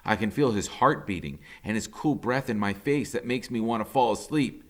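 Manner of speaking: ordinary delivery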